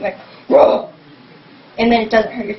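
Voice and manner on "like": a forced throaty rasp from the back of the throat; demo throat gasp. Shown as a demonstration. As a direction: push a raspy gasp out from deep in the throat. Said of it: "force out words while you're doing".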